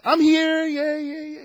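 put-on high, loud, bouncy child's "I'm here"; squeaky excited schoolkid; eager kid answer. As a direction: answer like a kid desperate to be noticed.

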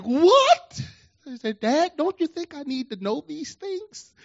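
high-pitched incredulous son; mock astonishment, voice leaping right up; shocked outcry. Son reacts to father's revelation.

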